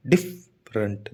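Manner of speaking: "डिफरेंट" as डिफ, pause, रेंट; split into syllables. Shown as a demonstration. Teacher showing students two sounds, "डिफरेंट" split in two. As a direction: say "डिफरेंट" slowly in two low, separate beats. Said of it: "दो sound है ना".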